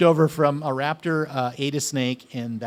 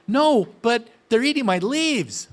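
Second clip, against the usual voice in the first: higher, louder protest; whiny complaint; 8 semitones higher, 6 dB louder than usual. Retold or imitated. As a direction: push back, upset and insistent. Client repeats complaint.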